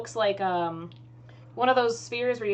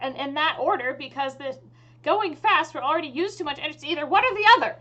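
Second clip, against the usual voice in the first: higher voice, rising insistence; fed-up engineer pushing back, pitched up; exasperated elf mage; 7 semitones higher, 5 dB louder than usual. Acted. Elf mage argues over the shield's energy, exasperated.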